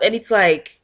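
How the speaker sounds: usual speaking voice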